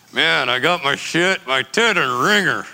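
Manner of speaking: mild imitation of talking through clenched teeth; jaw wired shut. Brian with his wired jaw explaining, slow mock mumble. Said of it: "came in with his jaw wired shut".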